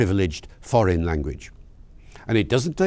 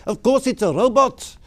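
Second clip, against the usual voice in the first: put-on high, loud-ish South African accent; mock-indignant South African accent; 11 semitones higher, 4 dB louder than usual. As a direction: imitate an emphatic South African driver. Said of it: "in a lovely South African accent".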